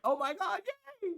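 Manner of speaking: high, squealing mock yay; mock excitement. Mocking people who cheer her leaving, high excited squeal. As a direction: imitate someone gushing with fake joy. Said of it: "They're like".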